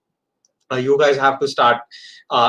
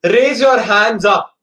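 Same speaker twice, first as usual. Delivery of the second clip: loud, slow classroom command; teacher command; 5 semitones higher, 5 dB louder, slower than usual. Acted. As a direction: bark it out like a teacher. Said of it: "The teacher says".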